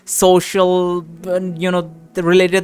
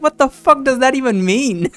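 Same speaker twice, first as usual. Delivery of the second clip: raised, disbelieving jab at a friend; incredulous retort; 7 semitones higher than usual. Retold or imitated. Ranting streamer mocks friend's field choice, incredulous. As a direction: scoff at the choice of study. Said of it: "So I was like".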